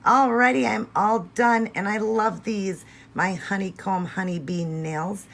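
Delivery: high, bright burst of delight, slowing down; excited reveal. Real, not acted. Vlogger thrilled to show her finished bee nails, excited.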